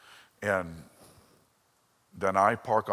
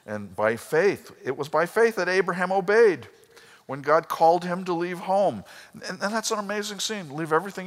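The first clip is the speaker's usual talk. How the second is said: full-force preaching; 10 semitones higher, 6 dB louder than usual. Acted. Pastor proclaims Abraham's faith to church, booming. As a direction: preach it out to the congregation.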